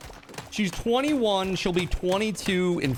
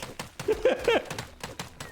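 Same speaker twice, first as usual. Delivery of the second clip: laugh; 4 dB quieter than usual. Real, not acted. Laughing at own joke.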